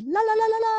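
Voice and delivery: high, loud run of la-las; imitated la-las. Co-host getting the Zoom video on her, high la-las. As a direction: mimic co-host's high la-la sound check. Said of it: "So she would be like".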